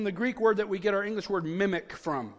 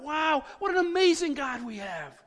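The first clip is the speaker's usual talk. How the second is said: higher-pitched, wondering exclamation; excited awe; 6 semitones higher than usual. Retold or imitated. Preacher reliving his reaction to studying scripture, excited awe. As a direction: let the amazement ride high, then fall away. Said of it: "we can't help but get excited".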